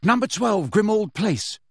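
Harry calling into the Floo; cried out. A narrator's reading. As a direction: call the destination clearly. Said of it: "and cried".

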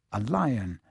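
usual speaking voice

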